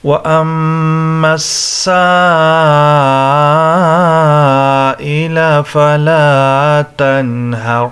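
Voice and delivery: slow, melodic tajweed recitation; Quran recitation. Acted. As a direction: recite in long, drawn-out measured tones.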